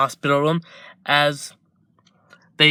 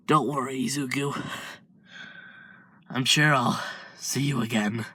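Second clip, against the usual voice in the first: dying brother's farewell; 6 dB quieter than usual. Acted. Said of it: "as Izuku is quickly crying".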